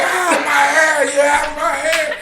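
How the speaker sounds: mild mock cry of pain. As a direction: whine about his hair, hurting. Les crying as mask pulled.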